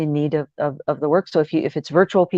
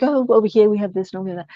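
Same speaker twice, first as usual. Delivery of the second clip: light imitation of a British accent, higher; 4 semitones higher, faster than usual. Coach imitating the woman at coffee, British accent. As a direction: lilt it in a mock British accent. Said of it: "She had this beautiful British accent".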